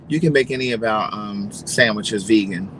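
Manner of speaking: put-on flat, bored counter-girl voice; a mild mock of a bored clerk; lackluster. Imitating the unfriendly clerk who answers with no energy. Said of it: "just very like this, very lackluster".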